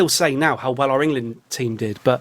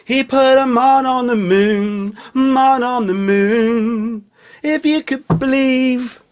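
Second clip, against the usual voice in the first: higher, slow, grandly drawn-out proclamation; mock grand orator; 7 semitones higher, 4 dB louder, much slower than usual. Riffing on 'man' to his viewers, hammed-up slow grand voice. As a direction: boom it like a historic speech, hammed-up.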